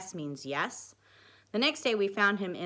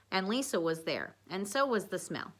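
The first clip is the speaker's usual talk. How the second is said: plain reading voice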